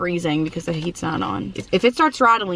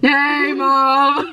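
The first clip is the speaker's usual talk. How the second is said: calling out; 5 semitones higher, 3 dB louder than usual. Real, not acted. Calling out to her mom.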